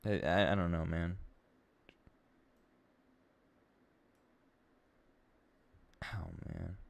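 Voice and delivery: very quiet, low and halting; hushed, lost-for-words disbelief; stunned, upset. Real, not acted. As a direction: barely get it out, with long pauses. Stunned, upset, long silences.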